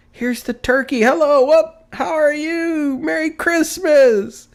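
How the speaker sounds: high, loud, stretched-out delighted greeting; gleeful hail. A narrator's reading. Scrooge hailing the poulterer's man, giddy and loud.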